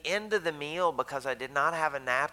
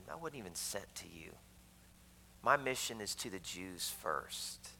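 soft repeated quote; 5 semitones lower, 10 dB quieter than usual. Retold or imitated. Preacher repeating Christ's words to the woman, very softly. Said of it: "Christ said".

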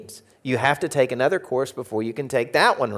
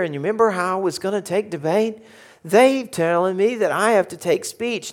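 put-on high-pitched teenage protest, building louder; hammed-up, high whine of injustice; mock complaint to mom; 7 semitones higher, 4 dB louder than usual. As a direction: put on an indignant act for your mom. Acts out his planned complaint to mom.

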